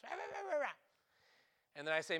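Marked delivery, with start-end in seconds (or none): 0.0-0.8 high-pitched mock barking; imitated dog yapping. Owner mimics his dogs as he comes home, imitated yapping. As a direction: yap like dogs going nuts. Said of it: "My dogs just start going nuts".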